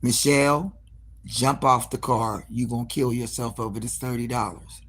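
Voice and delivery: low, soft inner voice talking sense; quietly warning herself to jump off. Retold or imitated. Her own mind warning Michelle, hushed and low. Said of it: "my first mind said".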